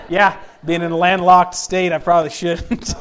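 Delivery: loud, slow words coming out of a laugh; real laugh carried into the words. Real, not acted. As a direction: laugh while admitting it. Laughing with the congregation.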